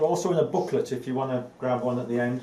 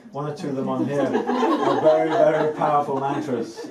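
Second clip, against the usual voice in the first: laughing; 5 dB louder than usual. Real, not acted. Amused warning about strong mantras.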